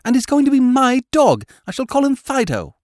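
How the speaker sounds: put-on a small boy putting his foot down; determined. A narrator's reading. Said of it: "with a determination".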